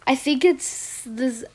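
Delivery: normal talking voice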